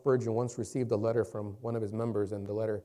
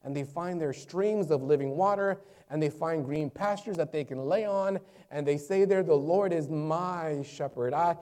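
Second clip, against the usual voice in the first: raised, emphatic proclamation; impassioned preaching; 5 semitones higher, 5 dB louder than usual. Real, not acted. Preacher proclaiming the Lord as shepherd, impassioned preaching. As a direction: declare it with forceful conviction.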